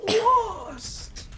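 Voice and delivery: very high-pitched Lost. Retold or imitated. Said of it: "he does this, like, high-pitched".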